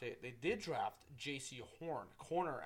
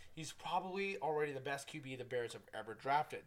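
ordinary delivery

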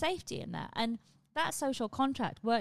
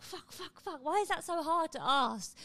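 frustrated; 7 semitones higher, slower than usual. Retold or imitated. Frustration after the meeting.

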